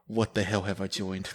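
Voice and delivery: soft, slow, low muttered disbelief; stunned inner thought. Retold or imitated. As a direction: say it to yourself, dazed. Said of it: "I'm just like".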